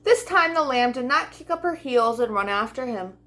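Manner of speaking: plain reading voice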